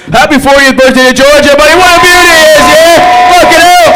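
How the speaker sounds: high, loud hype-man shout; excited hype. Real, not acted. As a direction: hype the crowd up. Hyping up the birthday boy.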